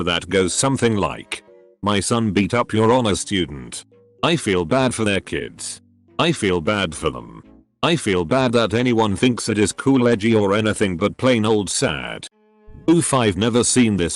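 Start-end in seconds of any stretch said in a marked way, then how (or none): none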